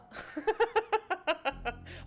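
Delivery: high laughing burst; burst of laughter. Real, not acted. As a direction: crack up at yourself. Laughs at own outburst.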